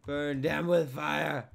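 slow, loud drawn-out command; over-the-top slow battle order; mock war cry. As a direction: holler it, leaning on every word.

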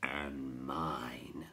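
low, whispery creature voice; crackling. A narrator's reading. Rock Chewer introduces itself, low crackle. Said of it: "the Rock Chewer crackled".

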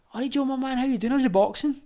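high-pitched mimicked small talk; imitated friendly greeting. Masked knifeman recognises the boxer, imitated high and casual. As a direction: put on a high, casual hello.

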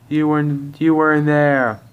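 high-voiced indignant retort; heated objection. Heated acted argument.

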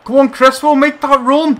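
high, loud calls to the runner; excited yelling; urging shout. Real, not acted. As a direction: push him on with your voice. Urging a player forward.

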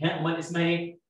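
usual speaking voice

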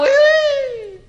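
very high, drawn-out "oui"; mock French squeal.

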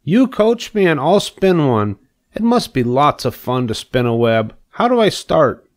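high, excited pig voice; eager pig. A narrator's reading. Pig asks spider to coach him, eager. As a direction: play a keen little pig raring to go.

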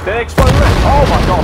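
loud, high, startled cry; startled shout. Real, not acted. Player after own bomb explodes, startled shouting. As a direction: shout it high, shocked by the blast. Bomb has just gone off.

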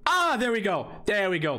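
real excited there we go; triumphant shout. Real, not acted. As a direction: burst out in victory. Finally wins after struggle.

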